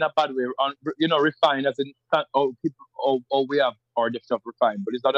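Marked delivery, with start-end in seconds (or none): none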